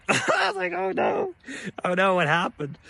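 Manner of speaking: squeaky high laughing oh no; laughing panic. Retold or imitated. Student laughs in disbelief at classmate's answer, high and panicky. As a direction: laugh in mock alarm.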